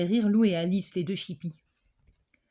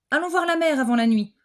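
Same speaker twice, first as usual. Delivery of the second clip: louder, brighter cheerful call; rally the class to go; eager invitation; 6 semitones higher, 6 dB louder than usual. Acted. Teacher invites the class to see the sea, louder.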